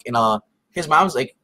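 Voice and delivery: usual speaking voice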